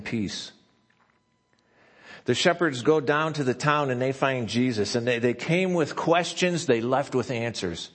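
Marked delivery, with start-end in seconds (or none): 2.3-7.9 louder, higher preaching climax; preaching at full force. Acted. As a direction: build the sermon to a fervent peak. Sermon builds to full force.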